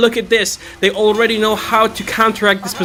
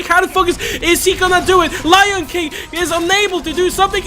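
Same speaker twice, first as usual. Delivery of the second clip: excited shouting; 7 semitones higher than usual. Real, not acted. Climax of tense point defense.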